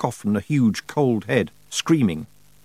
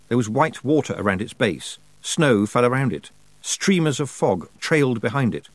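ordinary delivery

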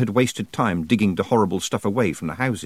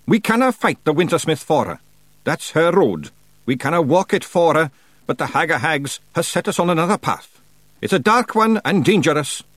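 high Scots voice, rising to loud; Feegle chieftain rallying; 7 semitones higher, 4 dB louder than usual. A narrator's reading. Rob warns his clan of the Wintersmith, high Scots voice. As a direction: announce it to the clan, building to a high, loud call.

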